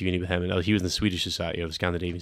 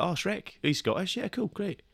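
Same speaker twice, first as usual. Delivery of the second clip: higher, softly voiced mock approval; casual pleased approval; 11 semitones higher, 3 dB quieter, slower than usual. Scot reacting to seeing Shrek on screen, casual pleased approval. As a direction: lightly act out being happily acknowledged.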